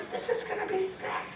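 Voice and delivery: can't wait to watch; giddy delight. Retold or imitated. Preacher voicing God gleeful before the prank, high and soft. God's excited aside.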